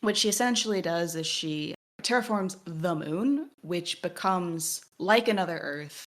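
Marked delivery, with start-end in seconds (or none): none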